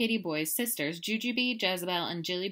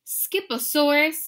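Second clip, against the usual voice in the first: loud, slow, higher declaration; declared; 10 semitones higher, 10 dB louder, slower than usual. Acted. Mama catching Skippy with the bones, loud. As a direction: announce the word with a scolding lift. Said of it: "declared Mama Junebug Jones".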